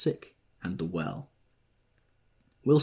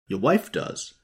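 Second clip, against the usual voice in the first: higher, startled exclaim; 4 semitones higher, 6 dB louder, slower than usual. A narrator's reading. Tom startled hearing Wilson's wife wants to leave. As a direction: catch him off guard. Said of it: "exclaimed Tom, startled".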